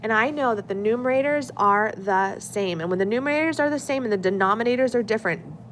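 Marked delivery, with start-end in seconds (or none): none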